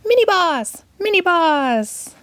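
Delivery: high, stretched minion cheer. A narrator's reading. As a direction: cheer like tiny gleeful minions. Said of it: "The minions cheered".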